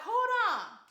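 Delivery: a high, loud, startled "Hold on." in a put-on voice; alarmed hold on. Reacting to the baby rising from the casket, loud alarmed hold on. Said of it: "And I'm like".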